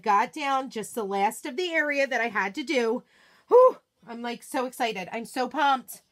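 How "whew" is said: whew of relief. Real, not acted. Relief after finishing the area.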